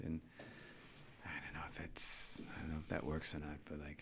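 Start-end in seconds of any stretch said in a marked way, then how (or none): 1.2-4.0 hushed whispered aside; whispering. Real, not acted. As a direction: drop to a whisper, muttering to yourself. Muttered aside about translator.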